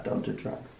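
unvoiced whisper of the line; whispered warning. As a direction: whisper it like a secret. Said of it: "when I say that".

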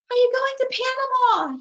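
high, breathless bird call. Acted. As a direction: gasp it out while chasing the cranes. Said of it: "he asked breathless".

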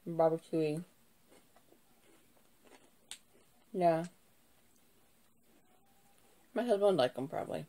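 chewing while talking; biting chip between words; mouth full. Real, not acted. Seen 0: Eats chips throughout; chip at lips, bites around 4-7s.

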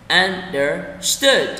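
understood broken into un, der, stood; slow syllable split. Shown as a demonstration. Teacher breaks the word down for learners, slowly split. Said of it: "đọc chậm từng từ một".

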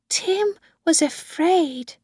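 put-on high, slow child voice; little girl Susan. A narrator's reading. Little girl explains herself.

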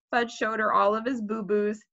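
ordinary delivery, nothing marked

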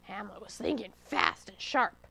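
usual speaking voice

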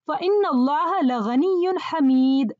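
fast chanted Arabic verse, فَإِنَّ leading; quran recitation. Shown as a demonstration. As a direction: recite the verse quickly and evenly. Quoting a verse as example.

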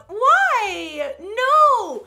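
high, loud drawn-out wail; mock despair. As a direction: wail it in hammed-up protest. Mock despair at feeling ugly.

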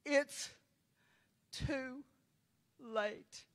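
each word alone, grieving and quiet; sorrowful Jesus. Retold or imitated. Preacher voicing Jesus over a lost soul, sorrowful. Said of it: "with his head hung down, shaking".

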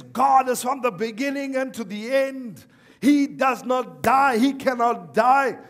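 loud, high sermon delivery; mock preaching. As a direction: act out a preacher driving home each phrase. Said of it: "he started to preach".

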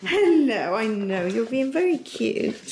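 drawn-out doting coo; cooing pet talk. Real, not acted. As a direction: fuss over an adorable dog. Cooing to the dog.

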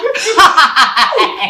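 manic laugh breaking down; crazed laugh. Acted. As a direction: cackle on the edge of tears. Mongryong cracking up while weeping.

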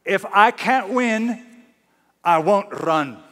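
high, emphatic imitation Scottish brogue. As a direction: put on a defiant Scottish brogue. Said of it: "says in his Scottish brogue".

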